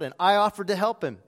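ordinary delivery

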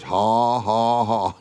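deliberate mock laugh in separate ha's; Brother Hagin's forced laugh. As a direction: act out making yourself laugh. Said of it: "making myself laugh like this".

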